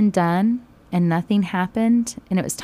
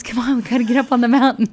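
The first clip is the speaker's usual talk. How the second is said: excited urging; 5 semitones higher, 6 dB louder, faster than usual. Retold or imitated. Townsfolk urging each other uphill.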